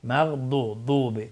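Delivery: مغضوب slow, tongue side on upper molars; heavy, drawn-out dad in مغضوب. Shown as a demonstration. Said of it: "کنار زبان بچسبه به دندانهای آسیای بالا".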